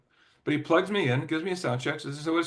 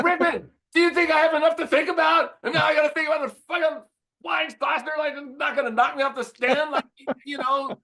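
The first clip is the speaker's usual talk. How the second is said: much higher and louder, a mock outraged yell; exasperated shout; 5 dB louder than usual. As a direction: throw it at him like a cornered, overloaded guitarist. Exasperated retort to lighting guy.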